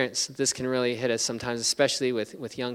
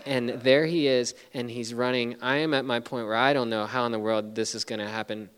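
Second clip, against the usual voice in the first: ordinary talk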